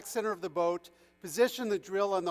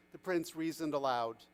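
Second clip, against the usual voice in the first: plain reading voice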